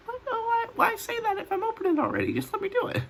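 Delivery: very high, fast whiny voice; squeaky complaint. Real, not acted. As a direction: squeak it out in annoyed disbelief. Annoyed rant about people saying "Don't do that.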